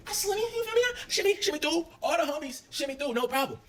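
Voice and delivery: high, bouncy showing-off voice; playful sing-song. Retold or imitated. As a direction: act out gliding through, pleased. Acting out sliding through.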